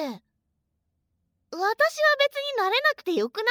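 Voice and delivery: high, loud defensive protest; flustered protest. Real, not acted. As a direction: push back, embarrassed.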